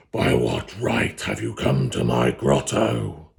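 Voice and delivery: low, slow, booming demon voice; roaring fire fiend. Acted. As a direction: rumble it like a forest fire. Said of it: "In a voice like the roar of a forest fire".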